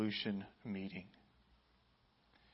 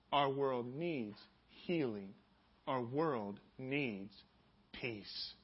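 solemn slow emphasis; much slower than usual. Acted. Solemn sermon climax.

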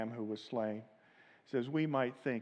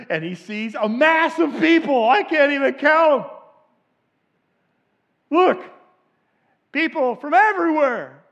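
high, loud, soaring preacher voice; preaching at full force; 12 semitones higher, 15 dB louder than usual. Real, not acted. Pastor rousing the congregation, full-force preaching. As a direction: lift the crowd with a swelling call.

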